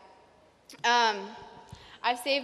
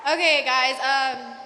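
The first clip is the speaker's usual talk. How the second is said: loud; 8 dB louder, slower than usual. Real, not acted. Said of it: "sorry if I'm really loud".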